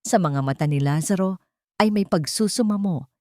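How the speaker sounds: usual speaking voice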